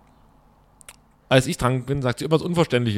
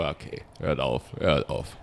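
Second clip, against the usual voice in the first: low, soft inner grumble; 11 semitones lower, 7 dB quieter, slower than usual. Retold or imitated. Annoyed man silently begging a noisy customer to stop, low mutter. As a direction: mutter it to yourself, much lower and softer. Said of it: "dachte ich mir so".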